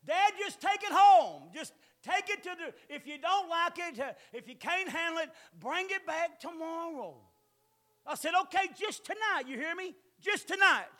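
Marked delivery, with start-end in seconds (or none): 0.1-7.2 aggravating daughter. Retold or imitated. Preacher mimicking his nagging daughter, high and loud. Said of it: "she's an aggravator". 8.4-10.9 firm giving in. Retold or imitated. Giving in, setting a firm limit.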